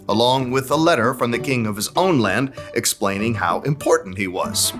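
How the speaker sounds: ordinary delivery, nothing marked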